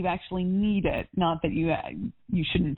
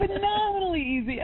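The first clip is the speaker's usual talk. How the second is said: phenomenally said much higher, beaming with delight; delighted echo; 12 semitones higher than usual. Real, not acted. Coach repeating the client's phrase with delight, high excited echo.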